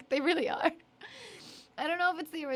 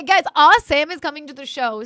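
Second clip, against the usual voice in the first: calling out; 13 dB louder than usual. Real, not acted. Announcing guest to chat.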